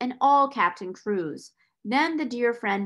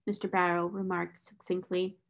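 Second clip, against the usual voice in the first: ordinary delivery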